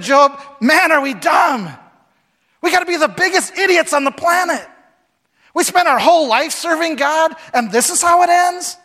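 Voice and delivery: high, loud exasperated outburst; a fed-up wife shouting at her husband; frustrated wife. Retold or imitated. Wife yelling at Job in frustration, high and loud.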